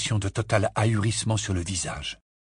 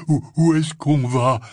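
loud, raised, winded stammer; puffed out; 4 semitones higher, 6 dB louder than usual. A narrator's reading. Hagrid puffing behind Harry down the corridor, winded. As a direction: ask it while short of breath. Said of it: "souffla Hagrid".

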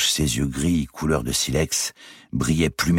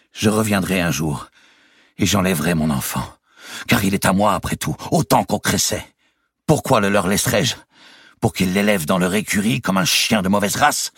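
climbing higher and louder as the grievance builds; rising anger; 6 semitones higher, 4 dB louder than usual. A narrator's reading. Nephew vents his anger about his child, growing heated. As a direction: let the resentment swell with each question.

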